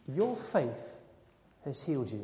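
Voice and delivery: tender, quiet assurance; gentle pronouncement. Acted. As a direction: say it hushed and low, with pauses. Jesus heals the blind man.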